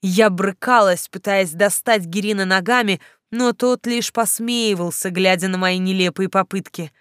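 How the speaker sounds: plain reading voice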